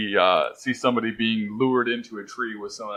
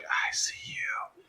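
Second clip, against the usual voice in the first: breathy unvoiced whisper; creepy tree whispering; 7 dB quieter, much slower than usual. Talking tree whispers at him.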